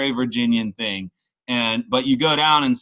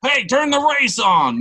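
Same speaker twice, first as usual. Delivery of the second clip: high, slow, loud hammed-up Southern holler; mock Southern call; 12 semitones higher, 4 dB louder than usual. Tipsy Virginian calling for the race, hammed-up Southern holler. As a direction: yell for the NASCAR race like a good old boy. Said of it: "secretly Southern".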